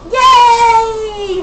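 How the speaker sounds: loud happy yelp; excited cheer. Real, not acted. Cheers when told one minute left.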